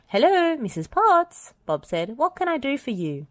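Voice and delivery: high, loud bright hello; cheery phone greeting. A narrator's reading. Bob answers his phone.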